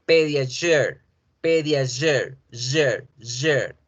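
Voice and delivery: "pediasure" with zh, "jure" alone; English Pediasure. Shown as a demonstration. Teacher drills the product name. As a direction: end "pediasure" in a buzzing "jure". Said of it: "ya no van a decirlo pediasure ni pediachur".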